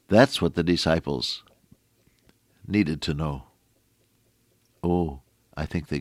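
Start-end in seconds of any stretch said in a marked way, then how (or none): none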